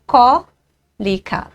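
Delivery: "cólica" stretched slowly, long "có", then "li-ca"; syllable by syllable. Shown as a demonstration. Teacher showing the antepenultimate stress in "cólica", syllable by syllable. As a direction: sound out "cólica" with a drawn-out stressed first syllable. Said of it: "A antepenúltima sílaba".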